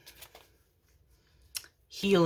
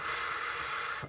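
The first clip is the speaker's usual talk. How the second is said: emotional sigh; 10 dB quieter than usual. Real, not acted. Emotional after the cards.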